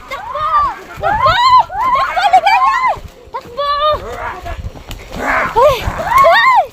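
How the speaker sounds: panicked yelling while running. Real, not acted. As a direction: shout high and loud while fleeing. Fleeing through forest, shouting.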